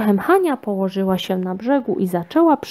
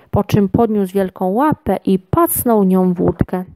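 plain reading voice

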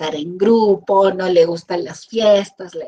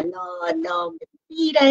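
mock whine; 9 semitones higher, 4 dB quieter than usual. Sad person brushing off comfort.

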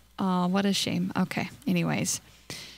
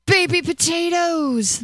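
delighted gasp; 8 semitones higher, 4 dB louder, slower than usual. Real, not acted. Delighted at baby potato idea.

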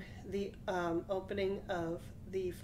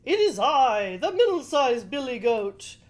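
loud, higher goat answering bravely; middle goat voice; 4 semitones higher, 10 dB louder than usual. Acted. Middle goat answers the troll, loud and higher. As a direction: call out proudly as the middle goat.

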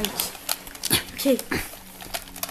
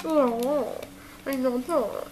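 lightly put-on, much softer voice; that "yeah" voice; 2 semitones lower, 9 dB quieter, much slower than usual. Puppet replying to friend's warning, soft put-on voice. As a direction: slip into the hushed character voice. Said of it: "You're doing that "yeah" voice".

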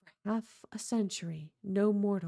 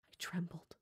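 breathless whisper; whispered tremble; 2 semitones lower, 11 dB quieter than usual. A narrator's reading. Montresor admitting his fear, in a hushed whisper. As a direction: whisper it as if shaking. Narrator trembling before the wall.

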